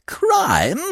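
sudden high shrill cry; shrill it out in shock; shrill outburst. A narrator's reading. Godfather shrilling at his godson's word. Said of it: "shrilled Monsieur de Kercadiou".

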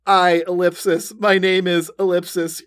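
ordinary delivery

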